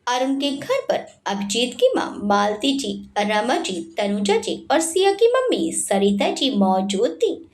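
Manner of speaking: ordinary delivery, nothing marked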